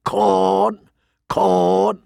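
high, long bird-like calls; raucous raven. A narrator's reading. Raven begs the Lord Commander for food, raucous. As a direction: squawk each word like a talking raven. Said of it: "it muttered in a raucous voice".

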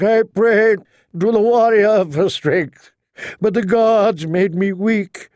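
high, slow, wailing supplication; weeping plea. A narrator's reading. Septon pleads with Lord Beric for mercy, weeping. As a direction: draw the words out, begging tearfully for mercy. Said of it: "The Septon wept and prayed".

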